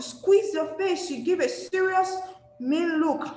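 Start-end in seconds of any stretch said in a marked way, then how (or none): none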